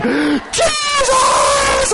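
high, loud cry of JESUS; screaming Jesus. Retold or imitated. Alone in the car, pastor screams the name Jesus. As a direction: scream out to God in overwhelm. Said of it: "I was just screaming".

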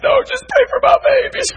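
very high, squeaky frantic plea; mock a fretful mother begging; hammed-up pleading mother. Mother insists again.

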